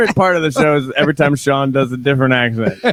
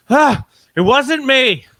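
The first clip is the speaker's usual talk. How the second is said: slow, higher, flustered and beaten; defeated, flustered; 7 semitones higher, slower than usual. Retold or imitated. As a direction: plead it, worn out and defeated. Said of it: "very flustered, just defeated".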